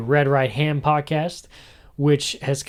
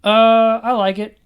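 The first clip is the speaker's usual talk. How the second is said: higher, louder lazy answer; hammed-up guest shrug; mock short answer; 10 semitones higher, 8 dB louder than usual. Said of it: "when I used to be like".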